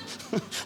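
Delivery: breathy then high burst of laughter; self-mocking laughter. Real, not acted. Preacher laughing at his own flaws, real laughter. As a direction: laugh at yourself, high and bright.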